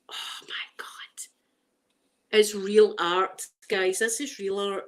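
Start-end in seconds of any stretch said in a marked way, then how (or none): 0.1-0.9 stunned whisper. Real, not acted. Overwhelmed by real art on wood, stunned whisper. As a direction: breath-only whisper of amazement.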